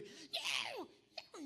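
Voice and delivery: high, insistent meows; imitated cat clamoring. Speaker imitating the cat begging once put down, high meowing. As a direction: meow like a cat that will not quit. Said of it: "él empieza a clamar".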